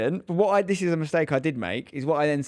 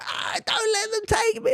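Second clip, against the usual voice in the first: put-on much higher, slow wailing child's plea; imitating a crying child; slower than usual. Dad imitates his son wailing at school drop-off. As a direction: mimic a panicking child sobbing at the gate. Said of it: "he's literally like".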